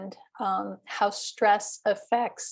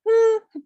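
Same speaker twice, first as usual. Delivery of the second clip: stress-pattern hum; 11 semitones higher, 6 dB louder than usual. Shown as a demonstration. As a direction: hum two beats, the first high. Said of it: "say the humming".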